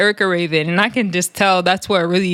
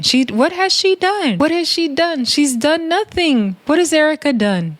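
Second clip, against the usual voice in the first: high-pitched imitation of a castmate's defense; high mock innocence, maybe shady; Natalie, possibly shady; 7 semitones higher than usual. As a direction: plead her case in a raised, put-on voice. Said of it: "you know the way Natalie talks, it's like she could be being shady".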